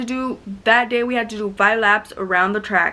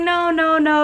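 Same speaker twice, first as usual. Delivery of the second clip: high, insistent mock refusal; pitched-up string of protests; retold refusal; 8 semitones higher, 3 dB louder, slower than usual. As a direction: imitate an aunt refusing to come back. Aunt's refusal acted out.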